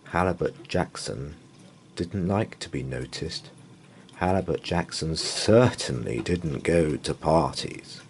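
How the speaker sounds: usual speaking voice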